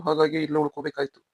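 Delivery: ordinary talk, nothing marked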